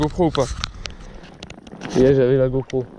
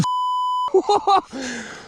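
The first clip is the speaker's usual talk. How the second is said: nervous laugh; 11 semitones higher than usual. Real, not acted. Nervous laugh after fright.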